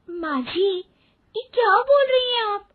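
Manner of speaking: high, crying and quick; tearful protest. Acted. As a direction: wail it through tears at mother-in-law. Daughter-in-law upset on stage.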